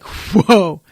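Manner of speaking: ordinary talk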